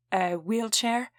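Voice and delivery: put-on slow, stern question in a thick Italian accent; thick foreign accent. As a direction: ask slowly in a heavy Italian accent. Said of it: "He, too, had a thick accent".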